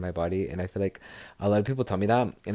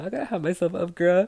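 excited; 10 semitones higher, 5 dB louder, faster than usual. Real, not acted. Hyping herself up after PRs.

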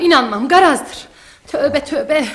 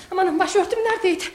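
higher, softer flustered muttering; 4 semitones higher, 6 dB quieter than usual. Acted. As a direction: search about in a dither. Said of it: "şaşırdım kaldım". (telaşlı, şaşkın)

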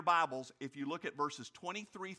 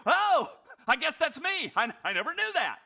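high, loud hammed-up surprise, far higher pitch; mock surprise; 10 semitones higher, 9 dB louder than usual. Preacher imitating Jesus surprised at the voice, mock astonished. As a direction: play a startled Jesus, hammed up. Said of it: "and go".

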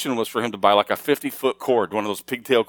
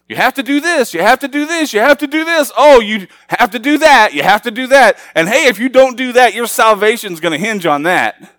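higher and louder, rattling off church rules; mock demands; 12 semitones higher, 10 dB louder than usual. Pastor mocking what churches demand, higher and louder. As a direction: hammer out each rule like a nagging preacher.

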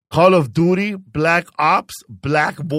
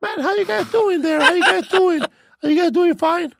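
put-on much higher young boy voice; real kid voice. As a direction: switch to a high squeaky boy.

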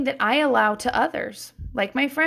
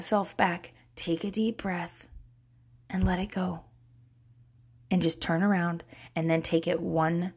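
softer, low guided-breathing voice; calming guidance; 4 semitones lower, 4 dB quieter than usual. Shown as a demonstration. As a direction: talk them gently down to calm. Leads a calming exercise.

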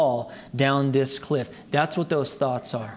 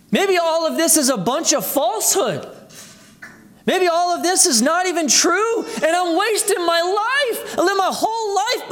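doubting inner voice; 12 semitones higher than usual. Retold or imitated. Preacher acting out a believer's doubts to the congregation, higher and anxious. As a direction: let the doubt rise like panic creeping in. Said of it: "You know the questions".

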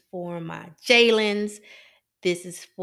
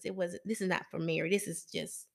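ordinary talk, nothing marked

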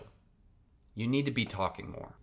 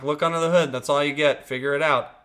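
louder, higher, dismissive instruction; mock curt order; 5 semitones higher, 7 dB louder than usual. Manual refusing to explain more, loud mock dismissive. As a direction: snap it out like an unhelpful manual, hammed up. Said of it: "It's like".

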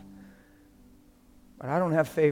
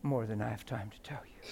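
whispered; 4 semitones lower, 4 dB quieter than usual. Acted. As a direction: repeat it in a hushed whisper. Repeated line, dropped to whisper.

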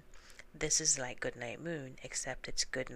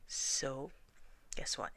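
usual speaking voice

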